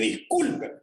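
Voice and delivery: high, hurried apology in a flustered woman's voice; desperate young woman. Retold or imitated. Purchasing clerk apologizing to the engineer, high and flustered. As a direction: play the panicked buyer owning her mistake. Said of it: "aquella muchacha desesperada me dice".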